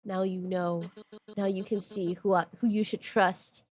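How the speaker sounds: low murmured threat; menacing old man. Acted. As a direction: quietly assert control.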